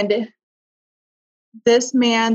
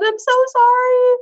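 very high, louder pleading sorry; desperate apology; 5 dB louder than usual. Retold or imitated. Begging her angry partner's forgiveness, high and pleading. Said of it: "but I said".

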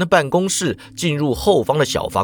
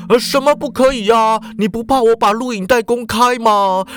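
higher, insistent coaching; stern boss; 6 semitones higher than usual. A narrator's reading. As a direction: lecture a newcomer on the script with pushy authority. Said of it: "十分严峻的就说了". (严厉教戏的社长)

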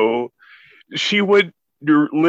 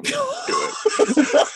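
high, squeaky dare; egging on. Real, not acted. Cohost daring her to pit [name] against [name], high squealed dare.

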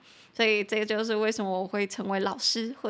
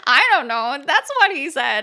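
loud, higher, just after a big laugh; amused protest; 5 semitones higher, 9 dB louder than usual. Real, not acted. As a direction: laugh it off loudly. Laughing off boss's compliment. (笑着大声辩解)

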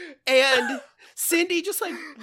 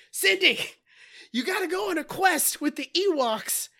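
higher, strained plea from a wounded father; mock dying urgency; 7 semitones higher than usual. Dad immediately shot, instructs Cindy, mock dying urgency. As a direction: imitate a shot dad giving last orders.

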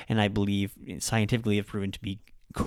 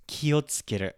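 "Kiotsukeru" starting low on "Ki", then higher; low-high pitch accent; 4 semitones higher than usual. Shown as a demonstration. Teacher models the word, "Kiotsukeru" with low first syllable. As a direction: step up in pitch after the "Ki" of "Kiotsukeru". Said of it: "Ki is lower".

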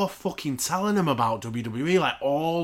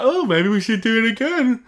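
high, louder mocking whine of a grinning boss; mock impression of a clueless boss; mocking Tony Khan; 9 semitones higher, 7 dB louder than usual. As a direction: sneer it out in a silly high voice. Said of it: "Terrence and Phillip flapping grin. Just like".

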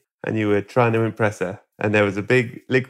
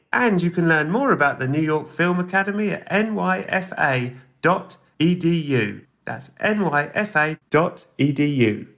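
upbeat, higher-pitched sponsor read; 8 semitones higher than usual. Acted. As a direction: sell it with a high, lively lilt. Reads a sponsor ad.

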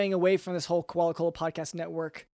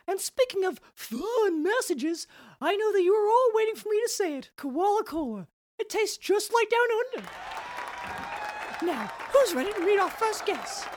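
high, chirpy koala mascot voice; cartoon mascot host; 11 semitones higher than usual. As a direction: play a cheesy corporate mascot host. Mascot character hosting.

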